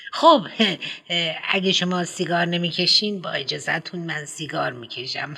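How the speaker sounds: eager headmistress rattling on; prim schoolmistress, brisk and eager; fussy headmistress. Acted. Headmistress lights a cigarette.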